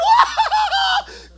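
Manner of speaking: high, loud whooping laugh; shrieking laugh. Retold or imitated. Narrator laughing as he shows off the bloody rat. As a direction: cackle out in wild triumph. Gloating over the fake kill.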